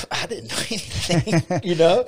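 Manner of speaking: loud, high, laughing through the words; real laughter breaking into speech; laughing admission. Real, not acted. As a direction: crack up while admitting it. Laughs at own past ignorance.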